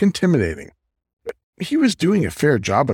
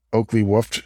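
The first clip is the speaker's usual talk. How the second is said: usual speaking voice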